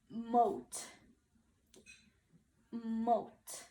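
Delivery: slow clear model word moat. Shown as a demonstration. Teacher modeling moat for kids to repeat. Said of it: "Now say it with me".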